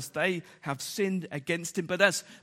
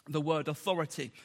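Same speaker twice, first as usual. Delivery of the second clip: normal talking voice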